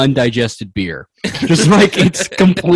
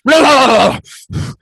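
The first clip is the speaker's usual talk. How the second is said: high, louder gagging blah sounds; sputtering babble; 10 semitones higher, 5 dB louder than usual. Acted. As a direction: splutter like you're spitting out beer. Uncle sputters after vomiting.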